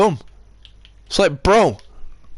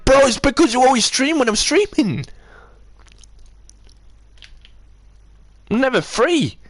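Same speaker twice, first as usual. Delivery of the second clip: heated, much higher complaint at Lewis; angry rant; 9 semitones higher than usual. Real, not acted. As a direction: vent the frustration, pitch climbing high. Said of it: "why do you think I'm angry".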